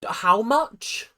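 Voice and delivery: shocked question. Real, not acted. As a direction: blurt it out in disbelief.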